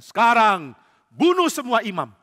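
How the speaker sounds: king commanding soldiers; barked command. Acted. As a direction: call the troops to attention. King orders killing.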